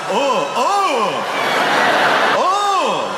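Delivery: high, rising startled oh oh. Retold or imitated. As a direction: caught off guard, startled. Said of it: "He really was like".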